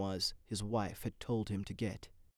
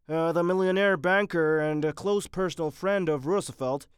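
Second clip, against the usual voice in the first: higher, louder doctor voice; haggard doctor; 5 semitones higher, 6 dB louder than usual. A narrator's reading. Doctor asking Mitty to examine the banker, strained. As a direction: play the worried surgeon, pressing on.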